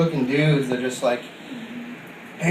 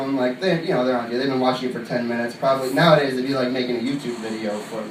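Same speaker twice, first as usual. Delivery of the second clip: ordinary talk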